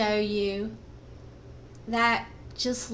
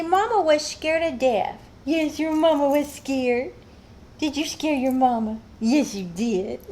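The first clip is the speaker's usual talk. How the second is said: high cooing pet voice; 4 semitones higher than usual. Real, not acted. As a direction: scold the dog sweetly. Baby talk to dog.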